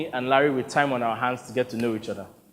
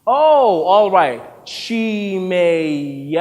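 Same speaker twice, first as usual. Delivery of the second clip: louder, then a slow, careful "Chimezie"; director attempting name; 3 semitones higher, 5 dB louder, much slower than usual. Director struggling to say Victor's name, careful. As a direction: try the foreign name slowly, unsure.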